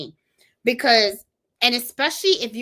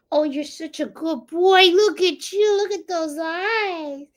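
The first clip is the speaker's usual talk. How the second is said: high, doting pet voice; 7 semitones higher, 4 dB louder than usual. Real, not acted. Baby talk to dog.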